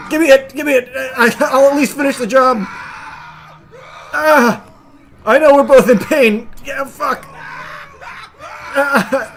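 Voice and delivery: frantic shouting. Pretend. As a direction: yell orders mid-fight. Voicing fighter in frenzy.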